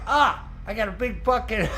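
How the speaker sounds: high, loud retold shock breaking into laughter; startled exclamation. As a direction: replay the sudden surprise. Retelling shock at screen change.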